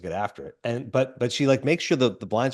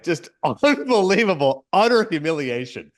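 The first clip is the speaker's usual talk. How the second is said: laughing; 4 semitones higher, 4 dB louder than usual. Real, not acted. Laughing at the film's cruelty.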